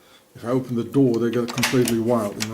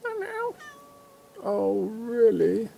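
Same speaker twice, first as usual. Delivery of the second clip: cooing; 8 semitones higher, 4 dB quieter, slower than usual. Acted. Cooing at the cats.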